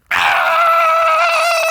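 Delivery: loud high scream into laughter; exasperated scream. Real, not acted. Fed up with the episode, screaming then laughing.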